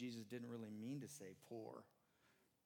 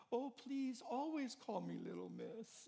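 put-on high, pleading teen girl voice, slower; teary granddaughter; 12 semitones higher, 4 dB louder, slower than usual. Granddaughter begging grandpa to keep the nickname, high and pleading.

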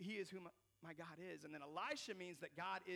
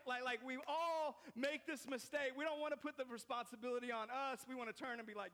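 ordinary delivery

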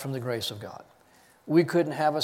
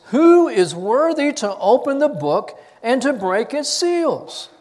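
raised, much higher voice, calling out to all heaven; loud proclamation; 9 semitones higher, 7 dB louder than usual. A narrator's reading. Strong angel proclaiming to heaven, loud and high. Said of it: "proclaiming with a loud voice".

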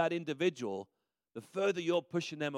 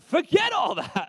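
excited dismissal; 9 semitones higher, 6 dB louder than usual. Acted. Father welcoming son back.

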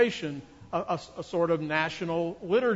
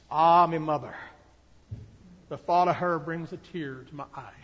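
lightly put-on Scottish accent. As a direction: sentimental line in a hammed-up Scottish accent. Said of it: "That's my best Scottish accent".

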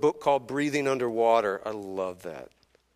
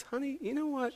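imitating a kind wife, softer and lilting; gentle, careful wifely advice; nicely, gently; 3 semitones higher, 5 dB quieter than usual. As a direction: start high and soft, then settle tenderly. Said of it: "she very nicely just says".

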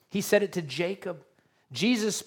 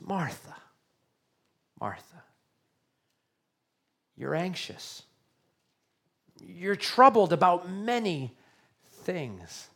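comforting; slower than usual. Retold or imitated. Preacher voicing Jesus gently comforting anxious Martha. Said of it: "in a comforting way".

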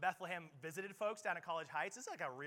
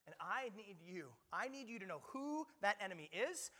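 an urgent charge that grows louder; building sermon; 5 semitones higher, 6 dB louder than usual. Acted. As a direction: rise to a rallying cry. Paul urging the Ephesians.

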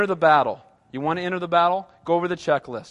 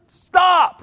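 loud, much higher sudden shout; exasperated stop; 11 semitones higher, 10 dB louder than usual. Acted. Pastor cutting off a whining traveler with a loud shout. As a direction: cut the whining off with a yell.

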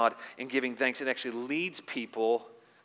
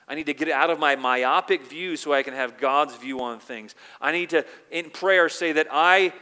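prayer building louder and higher; impassioned prayer; 3 semitones higher, 9 dB louder than usual. Acted. Preacher voicing a believer surrendering to God, building loud. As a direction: cry out your need for God.

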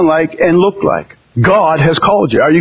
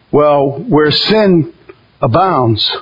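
normal talking voice

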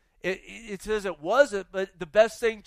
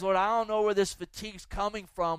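ordinary delivery

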